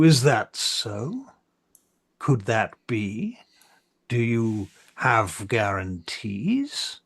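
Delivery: very slow, drawn-out questions; questioning councillor. Acted. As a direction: press the envoys slowly, each question rising at the end. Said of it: "one of the others turns to you".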